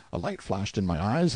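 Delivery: ordinary delivery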